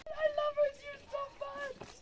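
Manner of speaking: very high cooing voice. Real, not acted. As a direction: gush in a squeaky high coo. Gushing over a pet.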